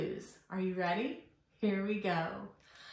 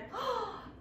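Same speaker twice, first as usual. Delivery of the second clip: startled gasp; 11 semitones higher, 6 dB quieter than usual. Acted. Gasp after scared demo.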